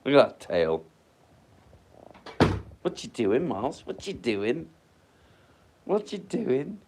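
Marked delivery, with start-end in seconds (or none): 2.8-6.8 high, rising pet talk; cooing at dog. Real, not acted. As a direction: ask the dog sweetly, pitch climbing.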